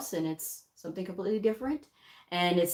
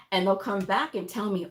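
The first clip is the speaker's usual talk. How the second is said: usual speaking voice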